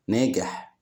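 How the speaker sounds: ordinary talk, nothing marked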